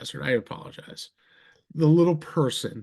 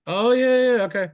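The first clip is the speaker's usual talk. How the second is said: mock drunk realization; 8 semitones higher, 5 dB louder than usual.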